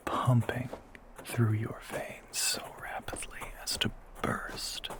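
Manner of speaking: a soft threatening whisper; menacing whisper. Acted. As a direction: breathe it into her ear like a hunter.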